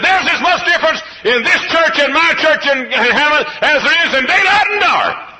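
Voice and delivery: high, loud preacher's cry with a laugh in it; retold preaching. Pastor retelling what he preached at another church, loud preaching. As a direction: replay the sermon line at full pulpit volume. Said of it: "Tuesday night I was preaching".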